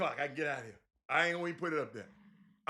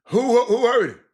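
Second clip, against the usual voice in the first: loud, rising disbelief at the claim; incredulous shout; 5 semitones higher, 8 dB louder than usual. Real, not acted. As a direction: demand who said it, loudly.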